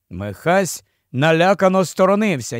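ordinary delivery, nothing marked

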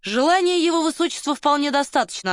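high, loud rebuff; curt retort. A narrator's reading. Colonel shutting down the journalist's question, loud and curt. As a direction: cut the question off forcefully. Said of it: "отрезал полковник".

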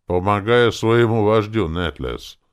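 ordinary delivery

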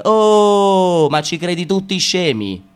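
normal talking voice

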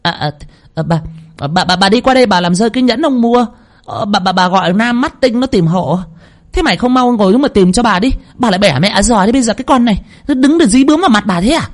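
stumble, then turn on the maid; flustered cover-up. A narrator's reading. Caught second wife stammers an excuse, then scolds sharply. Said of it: "mụ cũng hắng giọng".

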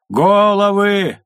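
long drawn-out yell, high and loud; shouted warning. Acted. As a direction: bellow the warning across the wall.

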